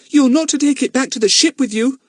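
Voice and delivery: put-on high, loud bossy girl. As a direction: order him like a bossy sister. Dinah bossing.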